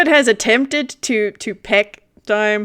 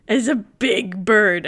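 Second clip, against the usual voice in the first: normal talking voice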